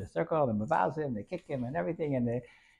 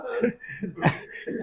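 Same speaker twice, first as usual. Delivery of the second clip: breathy laugh; 8 semitones higher than usual. Real, not acted. Teller laughs after punchline.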